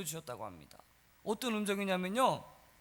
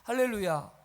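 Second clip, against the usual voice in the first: normal talking voice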